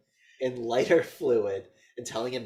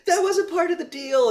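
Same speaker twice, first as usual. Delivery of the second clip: shrill mock cry, far higher and louder, breaking into a laugh; mock frantic shout; 9 dB louder than usual. Mother yells at the killer on the phone, hammed-up high shout with a laugh. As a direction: ham up a panicked mother yelling down the phone.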